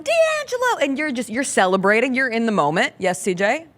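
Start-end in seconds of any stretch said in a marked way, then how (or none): 0.0-0.8 high-pitched mock yell at normal volume; imitated yelling. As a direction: imitate a friend calling out a name. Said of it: "and Chris is yelling".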